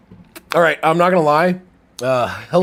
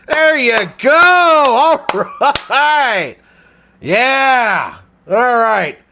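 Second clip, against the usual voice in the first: high, loud excited cheer for the Packers; cheering a touchdown; 11 semitones higher, 8 dB louder, much slower than usual. Real, not acted. Streamer cheering a Packers touchdown, high and loud. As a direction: shout it out as your team scores.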